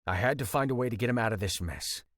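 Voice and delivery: ordinary delivery, nothing marked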